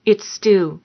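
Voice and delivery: usual speaking voice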